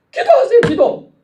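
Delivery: very high, forceful exhortation; emphatic command. Real, not acted. Preacher answering the excuse with a command, emphatic cry. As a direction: fire back the command at high pitch.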